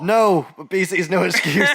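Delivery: loud, laughing slogan read; laughing mock slogan.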